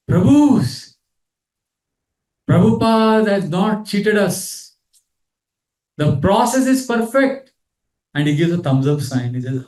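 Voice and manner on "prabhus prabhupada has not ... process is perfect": high, slow, awed voice of a dying man; high and slow, amazed at the end; dying devotee's quote. Retold or imitated. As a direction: lift it high and slow, in wonder. Dying devotee's last words quoted.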